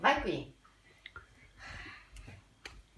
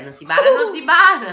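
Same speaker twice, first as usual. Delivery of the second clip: firm, louder telling off; loud scolding; 5 semitones higher, 9 dB louder than usual. Real, not acted. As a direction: scold them for cheating. Scolding cheating in arm wrestling.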